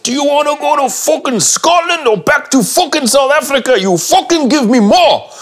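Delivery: high, full-force furious screaming; Padraig Mullen. Retold or imitated. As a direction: scream foul-mouthed demands at a flagging swimmer. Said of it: "Padraig's now screaming at me".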